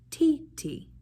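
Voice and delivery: "ti, ti" chanted high and crisp; rhythm syllables ti ti. Shown as a demonstration. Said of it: "Say that, go".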